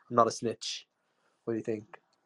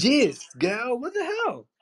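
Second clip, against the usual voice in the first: high-pitched startled exclamation; shocked; 11 semitones higher, much slower than usual. Real, not acted. Man reacts to her talk of sleeping with you, shocked. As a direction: blurt it out in shocked disbelief, pitch leaping up.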